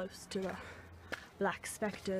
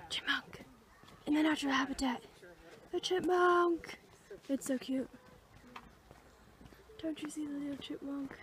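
excited hush; 7 semitones higher than usual. Real, not acted. Excited at seeing chipmunk.

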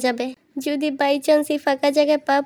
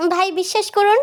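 high, urgent plea; desperate pleading; 7 semitones higher than usual. Acted. Accused man to bystander, pleading. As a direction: beg to be believed, pitch raised. Begs bystander to believe him.

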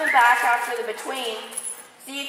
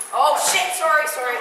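startled; 5 semitones higher, 5 dB louder than usual. Real, not acted. Bad throw surprise.

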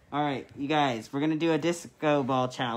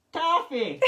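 high-pitched mock-British, breaking into laughs; silly, high British-accent attempt; 12 semitones higher, 3 dB louder than usual. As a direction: try British and crack up. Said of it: "do it in a British accent".

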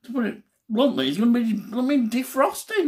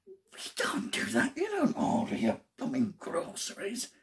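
self-scolding whisper; 3 semitones lower, 8 dB quieter than usual. Real, not acted. Man scolding himself for a match-day order, whispering.

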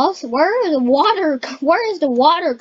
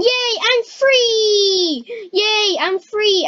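high, stretched-out celebration; jubilant cheer; 7 semitones higher, much slower than usual. Pretend. Player escaping the game, jubilant cheer. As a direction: cheer with long, high vowels.

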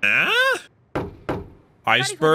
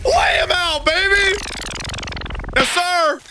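high, shouted cheering; hype shout; 12 semitones higher, much slower than usual. Real, not acted. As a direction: fan roaring at a big punch. Cheering a big hit.

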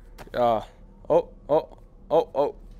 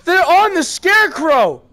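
high, loud, drawn-out yell; alarmed shout; 13 semitones higher, 8 dB louder than usual. Real, not acted. Alarmed shout during game action.

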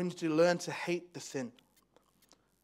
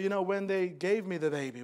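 usual speaking voice

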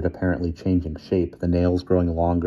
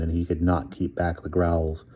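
ordinary delivery, nothing marked

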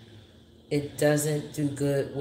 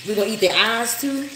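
high, loud incredulous question; can't believe what you're hearing; disbelief; 6 semitones higher, 5 dB louder than usual. Real, not acted. To the viewers about eating fish eyes, incredulous.

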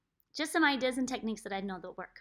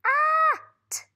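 bright at; 8 dB louder than usual. Shown as a demonstration. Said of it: "rev like a motorcycle".

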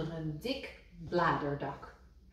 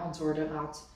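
usual speaking voice

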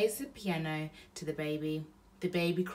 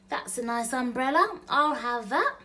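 higher and louder sly burglar; intruder voice; 7 semitones higher, 7 dB louder than usual. Acted. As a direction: voice the other thief greedily. Intruder's voice speaks.

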